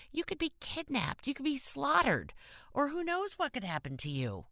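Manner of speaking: high, rising scolding rant; scolding lecture. A narrator's reading. Kathy scolding the boy about hitchhiking dangers, high alarmed rant. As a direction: scold him like a frightened mother.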